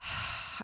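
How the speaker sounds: weary sigh, imitated; heavy sigh. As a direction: drop onto the couch with a sigh. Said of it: "sit down on the couch and be like".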